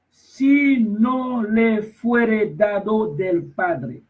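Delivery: slow, high, drawn-out reading of Christ's words; slow preacher reading scripture aloud; solemn scripture reading. Acted. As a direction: read the verse like a preacher, with long pauses.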